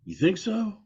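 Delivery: imitated unsure asking; retold questioning. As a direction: pitch leaps up on the question. Retold doubtful question to Brett.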